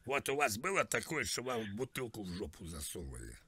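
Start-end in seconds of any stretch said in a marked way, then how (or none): none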